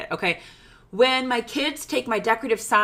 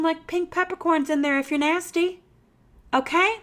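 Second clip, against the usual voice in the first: usual speaking voice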